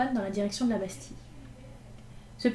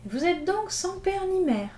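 much higher, slow grand manner; majestically; 9 semitones higher, slower than usual. Acted. Gavroche asking the boys grandly about their parents, lordly. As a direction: play the lordly protector. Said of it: "reprit majestueusement Gavroche".

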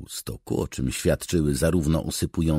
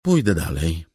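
obstinate, raised voice; deaf to argument; 4 semitones higher, 6 dB louder than usual. A narrator's reading. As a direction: repeat it, refusing to listen. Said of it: "rzekła głucha na wszelkie tłumaczenia". (uparcie, głucho na argumenty)

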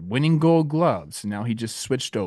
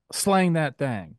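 award announcement; 3 semitones higher, slower than usual. Acted.

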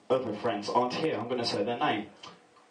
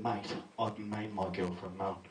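deadpan; 6 semitones lower, 7 dB quieter, slower than usual. Retold or imitated. Deadpan punchline.

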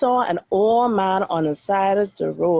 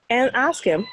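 plain reading voice

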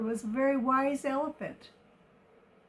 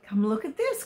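usual speaking voice

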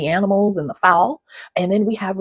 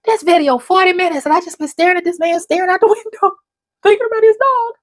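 high, louder mock exclaim with a laugh; amused disbelief; 11 semitones higher, 4 dB louder than usual. Realising she watched a man at a window, high amused exclaim. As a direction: catch yourself in amused surprise. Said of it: "I'm like".

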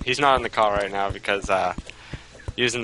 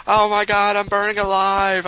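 high panicked yell, louder; 9 semitones higher, 4 dB louder than usual. Pretend. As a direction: scream as if burning alive. Character burning in game.

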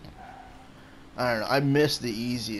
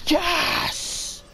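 very high, squealed celebration; triumphant yes. Real, not acted. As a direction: let the cheer crack up high. Celebrating a goal.

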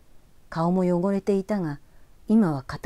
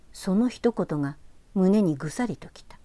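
ordinary delivery, nothing marked

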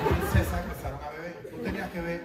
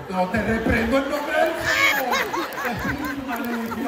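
hammed-up rebuking of an evil spirit, slow and louder; mock exorcist; 7 semitones higher, 6 dB louder than usual. Comic rebuking a spirit in the kitchen, hammed-up exorcist voice. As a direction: play a pompous exorcist casting out a demon.

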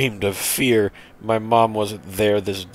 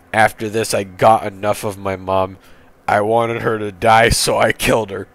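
usual speaking voice

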